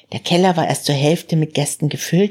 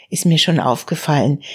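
plain reading voice

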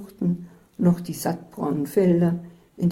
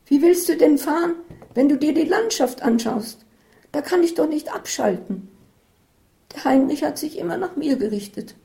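much higher, nagging fretful voice; anxious complaint; 10 semitones higher, 4 dB louder than usual. A narrator's reading. Isabel nagging Katharina about the scenery, high and anxious. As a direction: complain and compare her to Heinrich.

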